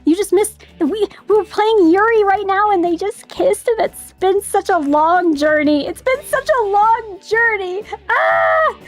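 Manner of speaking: high, giddy, gushing fangirl voice; cute anime streamer. Real, not acted. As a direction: squeal over the ship like a fan. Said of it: "cute anime streamer guess".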